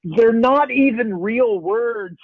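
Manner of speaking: high, drawn-out mock wail; imitating tearful daughter. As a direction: play a child fighting back tears. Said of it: "she's crying a little bit".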